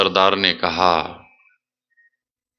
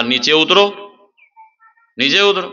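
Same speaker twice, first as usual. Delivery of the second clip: high, raised command; calling up; 8 semitones higher than usual. Acted. Bandits ordering the frightened man down from the tree, called-out command.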